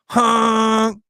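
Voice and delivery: high loud honk sound; imitated car honk. Driver at green light honking at old lady, a loud honk. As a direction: blare it like a car horn.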